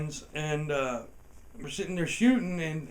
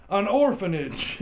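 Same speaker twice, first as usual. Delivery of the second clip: mock cop voice; 5 semitones higher, 8 dB louder, slower than usual. Cop's deadpan reveal.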